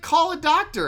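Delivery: high, loud quoted plea; mock cry for help. Podcaster repeating Usagi's plea, loud mock cry. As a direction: call out urgently as the sick man.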